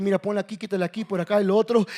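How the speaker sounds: ordinary talk, nothing marked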